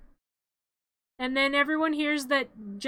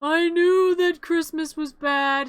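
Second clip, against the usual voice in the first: slow put-on character voice; 4 semitones higher, 6 dB louder, slower than usual. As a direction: drawl it out in a put-on voice. Narrating a character's line.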